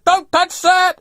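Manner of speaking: high-pitched, loud warning shout; imitating a man yelling across a hangar; retold yell. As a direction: shout to stop someone right now. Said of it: "He yells".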